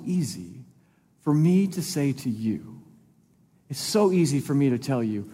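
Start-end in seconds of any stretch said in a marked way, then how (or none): none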